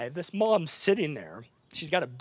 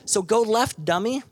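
higher and louder, a teasing jab; mock teacher's bark; 7 semitones higher, 5 dB louder than usual. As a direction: jab it at the class like the old professor. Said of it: "Doctor Sanukian".

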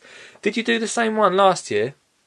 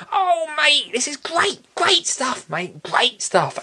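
very high gushing praise; put-on high voice, talking over the confession; hammed-up high-pitched delight; Biggsy impression; 10 semitones higher, slower than usual. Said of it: "He didn't speak like this".

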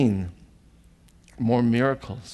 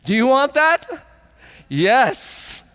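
calling out; 12 semitones higher, 5 dB louder, slower than usual. Real, not acted. Preacher calls out to congregation.

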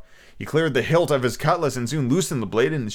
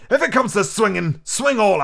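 loud cry; 9 semitones higher, 4 dB louder, slower than usual. Acted. Captain shouts.

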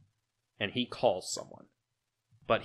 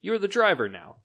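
cold boss order; 10 semitones higher, 7 dB louder than usual. Acted. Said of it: "looks at the other guy and says".

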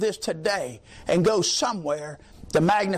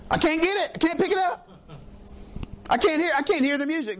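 high, laughing complaint; laughing at himself; 7 semitones higher, faster than usual. Real, not acted. Preacher joking about foreign languages, laughing and high. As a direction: chuckle through the words.

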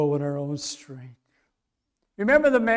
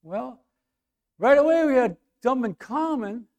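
ordinary talk, nothing marked

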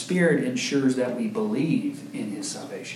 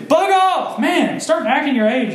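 mock snap; 11 semitones higher, 4 dB louder than usual. Joking mock rebuke to toddlers.

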